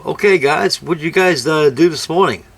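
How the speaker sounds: a hint of a teacher quizzing the class; imitating a teacher's morning question; teacher addressing class. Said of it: "he always asked us in the morning".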